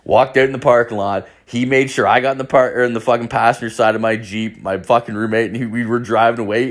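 plain reading voice

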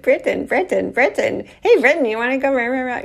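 playful imitating little brother; pestering little brother. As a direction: chant the name like a pesky kid.

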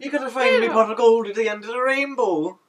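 high loud leprechaun voice; hammed-up Irish lilt; stage Irish. Mocking Irish stereotypes.

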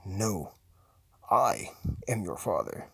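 deep, deliberate villain voice; mock-solemn movie villain; film villain quote. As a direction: drop low and pause between phrases. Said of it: "Who decided to say".

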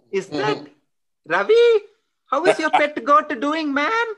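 high, delighted family greeting; thrilled to hear a cousin; excited recognition. Pretend. Greeting a relative on the phone.

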